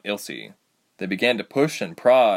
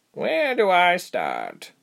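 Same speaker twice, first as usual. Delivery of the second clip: high, very slow; female creature; 9 semitones higher, much slower than usual. A narrator's reading. Creature answering the captive's challenge, drawn out. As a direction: stretch the question out in creature voice.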